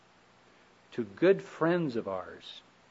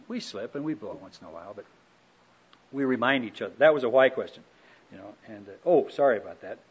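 ordinary talk

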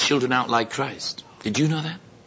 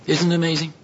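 ordinary talk, nothing marked

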